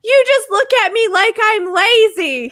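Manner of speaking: high, loud reenacted accusation; picking a fight. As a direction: replay a whiny-loud row with your partner. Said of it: "I picked a fight with him".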